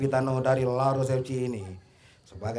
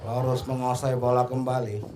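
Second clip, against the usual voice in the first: normal talking voice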